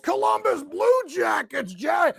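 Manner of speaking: loud, high hockey hype; excited shout. Real, not acted. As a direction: shout it out like a thrilled fan. Hockey fan hyping Blue Jackets.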